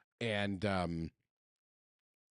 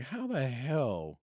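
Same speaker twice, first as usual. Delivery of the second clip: amazed disbelief; 3 semitones higher, much slower than usual. Retold or imitated. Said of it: "see him look at me like".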